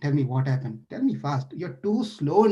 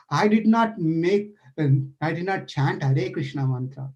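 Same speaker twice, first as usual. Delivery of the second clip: ordinary talk, nothing marked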